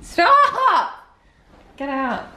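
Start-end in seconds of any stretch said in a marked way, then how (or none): 0.0-1.0 very high squealed protest; tickled squeal. Real, not acted. Being tickled by the other.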